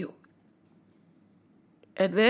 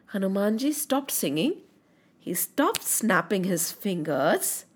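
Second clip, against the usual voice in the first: hushed; 5 semitones lower, 6 dB quieter than usual. A narrator's reading. Storyteller slows for dramatic hush.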